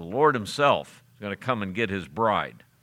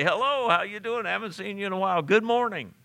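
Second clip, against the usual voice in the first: bright, much higher friendly hello to risen loved ones; cheerful greeting; 8 semitones higher, faster than usual. Pretend. Preacher voicing the risen dead greeting family, cheerful and high. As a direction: greet them like old friends at the graveside. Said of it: "and say".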